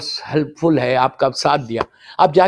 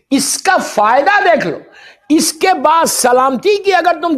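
impassioned preaching; 12 semitones higher than usual. Acted. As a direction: plead with the crowd at full force. Preacher exhorts congregation.

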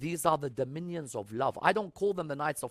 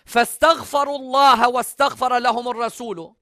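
high, loud, resonant Arabic recitation; Quran recitation; 10 semitones higher, 12 dB louder, much faster than usual. Acted. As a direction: recite the verse out, raised and projected.

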